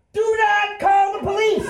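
very high, slow, emphatic holler, acted out; full-voiced alarmed shout. Storyteller as wanted driver to old couple, panicked shout. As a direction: bellow it to stop them cold.

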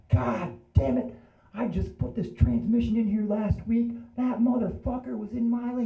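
retold furious cussing, pitch climbing high; imitated angry screaming. Storyteller imitating wrecked driver cussing, mock scream. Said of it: "He was screaming".

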